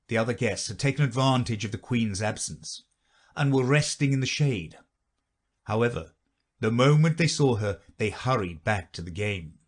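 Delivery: plain reading voice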